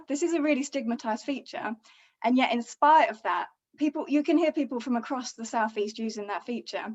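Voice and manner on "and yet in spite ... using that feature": ordinary talk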